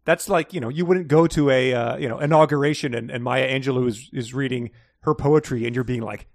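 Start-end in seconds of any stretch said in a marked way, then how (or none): none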